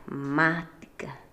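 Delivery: "mática" stretched out slowly, stress on MÁ; stressed syllable demo. Shown as a demonstration. Teacher showing students where the accent falls, "mática" slowly stretched. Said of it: "es una palabra esdrújula".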